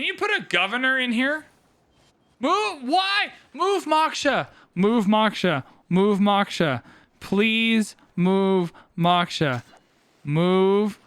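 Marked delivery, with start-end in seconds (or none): 2.4-4.5 high, urgent begging at the game; high-pitched exasperated urging; frantic pleading. Real, not acted. Streamer begging the AI to move Moksha, frantic and high. 4.7-11.0 insistent commands, slowing word by word; emphatic pleading. Real, not acted. Streamer ordering the AI to move Moksha, insistent and emphatic.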